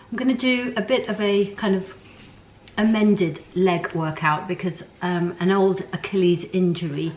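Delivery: normal talking voice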